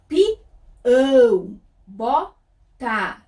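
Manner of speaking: "Pião, bota" broken into loud, drawn-out syllables; syllable-by-syllable sounding out. Shown as a demonstration. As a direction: sound out "Pião, bota" slowly, stretching each ending. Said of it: "olha o som, presta atenção". ("Pião, bota" bem silabado e destacado)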